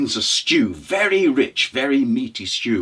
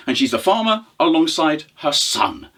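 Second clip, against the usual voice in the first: ordinary delivery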